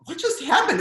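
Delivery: high stunned question; baffled, pitch jumps up. Retold or imitated. Parents after the scene, a high stunned question. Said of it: "my parents just were like".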